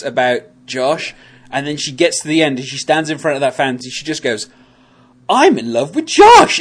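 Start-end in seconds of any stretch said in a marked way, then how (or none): 5.3-6.6 sudden loud gushing outburst; excited realization. Retold or imitated. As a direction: blurt it out, thrilled. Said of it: "the way she kinda says it".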